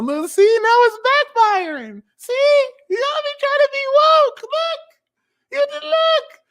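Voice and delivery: very high, loud squealing put-on voice; shrill teasing. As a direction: screech it like a mocking diva.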